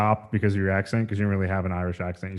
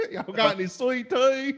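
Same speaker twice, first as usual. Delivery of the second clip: outraged yokel tourist complaining; hammed-up Southern caricature; 14 semitones higher than usual. Mock redneck tourist bit.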